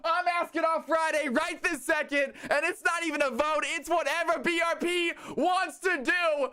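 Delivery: high, shouted party hype to chat; rally everyone to go wild; hype shouting. Real, not acted. Streamer hypes chat after gift.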